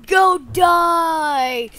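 loud drawn-out shout; hammed-up war shout; play battle cry. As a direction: yell it while attacking. Battle cry while attacking.

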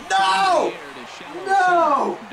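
sharp, high cries of no; dismayed cry. Real, not acted. Player reacting to his own blunder, high dismayed cries. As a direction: yelp at your own mistake.

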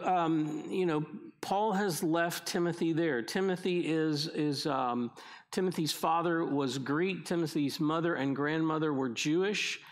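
ordinary talk

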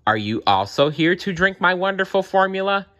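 high, insinuating villain question; mock-friendly villain. A narrator's reading. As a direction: taunt with a false welcome. Villain taunts the bear.

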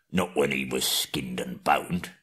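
a low, slow whispered troll aside; hushed. Acted. William the troll whispering about skinning. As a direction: breathe it like a greedy secret.

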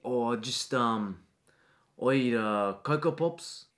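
low, soft, mumbling schoolboy; timid student. Acted. Shy boy answering a pushy teacher, soft and low. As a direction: shrink away from the teacher, quietly.

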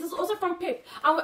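ordinary talk, nothing marked

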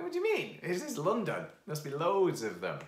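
high, loud voice of disbelief; incredulous protest. Retold or imitated. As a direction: protest loudly, pitch shooting up in disbelief. Disbelieving reply in retold argument.

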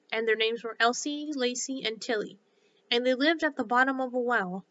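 usual speaking voice

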